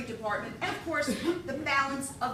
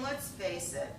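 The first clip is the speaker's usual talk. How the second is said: usual speaking voice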